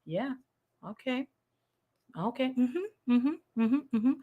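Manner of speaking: very soft, unbothered little acknowledgements; soft, spaced-out murmurs of patient agreement; calm listener. Retold or imitated. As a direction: imitate someone sitting back and quietly humoring. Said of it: "She just sits back and she said".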